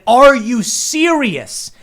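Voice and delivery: high, loud, slow disbelief; stunned at the mask rule; incredulous. Retold or imitated. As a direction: stretch it out in outrage. Outraged at the staff.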